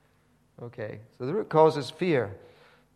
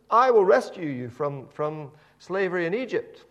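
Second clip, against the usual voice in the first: higher and louder earnest promise; Moses promising; 6 semitones higher, 8 dB louder than usual. Retold or imitated. Moses promising the Israelites rescue, raised earnest voice. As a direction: proclaim it like Moses.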